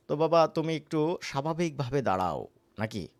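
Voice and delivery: ordinary talk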